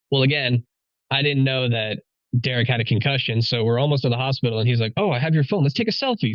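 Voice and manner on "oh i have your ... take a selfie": higher-pitched imitation of an oblivious friend; mock chipper friend. Concussed friend to bleeding buddy on way to hospital, mock chipper. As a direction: pitch it up, lightly mocking a cheery suggestion.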